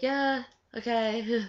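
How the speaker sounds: slow, drawn-out doubtful yeah, okay; skeptical okay. Retold or imitated. To her aunt's claim about dinner, a slow doubtful okay. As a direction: stretch it out, not buying it.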